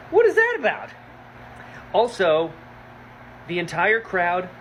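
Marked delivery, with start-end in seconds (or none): 0.1-0.9 much higher and louder, baffled; shocked question. Real, not acted. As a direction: blurt it out in disbelief. Shocked by plot reveal.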